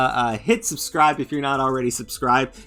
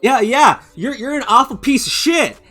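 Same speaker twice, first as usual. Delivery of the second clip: much higher, louder mock jab; hammed-up taunting insult; 10 semitones higher, 9 dB louder than usual. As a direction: fling the insult in a put-on voice. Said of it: "you say".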